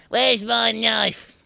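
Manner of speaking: high, slow, hammed-up sinister drawl; mock villain. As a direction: ham it up like a cartoon baddie. Mock menace after laugh.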